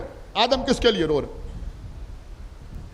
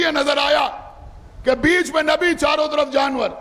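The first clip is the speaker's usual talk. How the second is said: fervent preaching; 3 semitones higher than usual. Real, not acted. Preacher to the majlis gathering, fervent full-force preaching.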